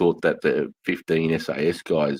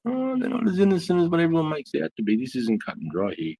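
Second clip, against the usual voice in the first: much higher, put-on voice for the realization; imitating himself; 12 semitones higher than usual. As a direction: squeak out the realization in a silly high voice. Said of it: "I'm like".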